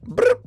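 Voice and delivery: high-pitched imitated computer blip; chat bot bloop. Streamer playing chat bot between messages, high mock bloop. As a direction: squeak out a quick electronic bloop.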